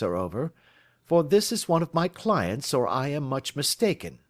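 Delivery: a lightly played sleuth holding forth; lightly voiced detective. Detective lecturing his friend on crime, lightly voiced and assured. Holmes muses on dull cases.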